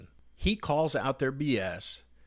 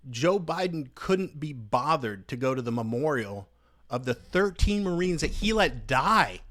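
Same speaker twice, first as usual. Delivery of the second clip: angry outburst; 3 semitones higher than usual. Real, not acted. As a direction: let the anger boil over. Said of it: "Sorry for that little outburst".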